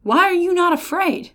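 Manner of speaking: high pleading question; urgent challenge. Real, not acted. Preacher urging the crowd to fear the warning. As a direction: press them hard. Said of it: "Amos is sounding a trumpet".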